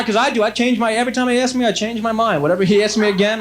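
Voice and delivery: usual speaking voice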